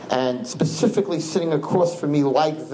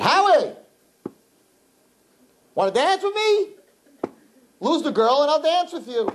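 much higher, slow, coaxing voice; playful invitation; slower than usual. Retold or imitated. Rabbi coaxing the tattooed boy to dance, high sweet voice. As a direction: sing it out sweetly, drawing him in. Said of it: "I said".

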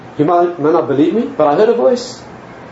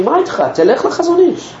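put-on high, excited old nurse voice; pitched-up old nurse pushing him to go; 12 semitones higher than usual. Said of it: "An old nurse walked past and she said to me".

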